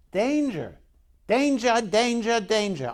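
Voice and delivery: imitating an excited wildlife presenter's alarm cry; Steve Irwin. Preacher imitates the clip to show Nehemiah's fear, mock alarm. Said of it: "Steve Irwin. That, that's exactly how".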